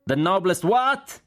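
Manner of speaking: high, slow, loud yell; shouted question. A narrator's reading. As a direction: shout back in disbelief, drawn out. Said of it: "gridò il generale Cork". (domanda urlata, acuta e lenta)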